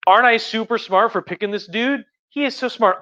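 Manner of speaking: high-pitched mock boasting; mock self-congratulation. Emperor boasts about his pick.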